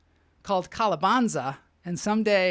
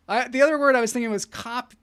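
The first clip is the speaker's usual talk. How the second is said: ordinary delivery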